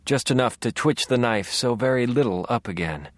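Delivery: ordinary delivery, nothing marked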